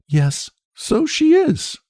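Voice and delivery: put-on slow, high, sour old woman; a grudging spinster's lie; Lottie voice. A narrator's reading.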